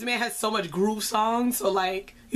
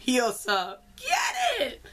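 laughing cheer, much higher; slower than usual. Retold or imitated. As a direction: hype the rapper up with a squeal. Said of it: "I was like".

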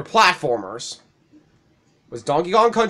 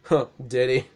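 ordinary delivery

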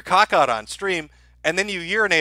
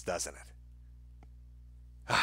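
a whispered, deflated tag question; soft, resigned whisper; 10 semitones lower, 20 dB quieter than usual. Real, not acted. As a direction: breathe it out in resignation. Gloomy rhetorical aside.

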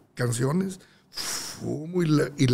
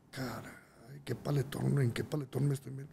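whispered aside; 13 dB quieter than usual. Retold or imitated. Dread at his situation.

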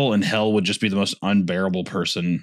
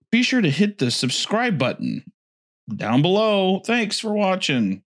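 higher, lilting mock host sign-off; video outro spoof; 7 semitones higher, slower than usual. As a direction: stretch out the farewell like a YouTuber.

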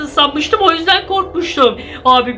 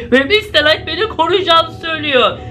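normal talking voice

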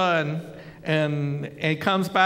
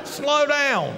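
alarmed whoa; 4 semitones higher than usual. Retold or imitated. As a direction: rein in an excited colleague. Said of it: "I came up almost out of my chair".

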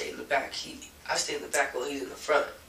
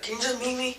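mild retold yell; 9 semitones higher than usual. Caller pressing the uncle to meet, mild retold yell. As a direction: imitate someone losing patience, pitch up. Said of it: "And he started yelling".

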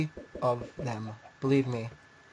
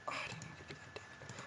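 muttered; 23 dB quieter than usual. Real, not acted. Muttered mistake.

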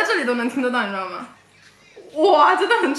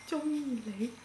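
stage whisper; 16 dB quieter, slower than usual. Acted. Mouthing Cantonese phrase for guessing.

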